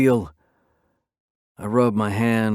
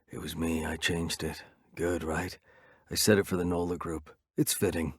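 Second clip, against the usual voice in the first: much softer, hushed and pleased; whispering; 4 semitones lower, 8 dB quieter than usual. Acted. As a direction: keep it hushed in his ear, a bit lower. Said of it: "Slingshot whispers in my ear".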